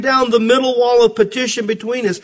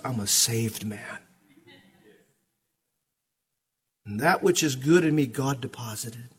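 quiet confession; 5 semitones lower, 6 dB quieter than usual. Retold or imitated. Quiet testimony reply to arguer.

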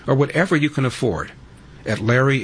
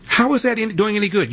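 exasperated; 10 semitones higher than usual. Real, not acted. Host scolding a prank caller.

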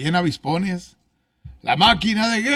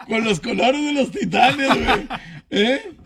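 laughing through the words, pitched higher; 8 semitones higher than usual. Real, not acted. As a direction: crack up while teasing about the colours. Joking jersey colour prediction.

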